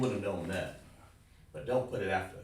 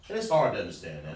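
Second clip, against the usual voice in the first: normal talking voice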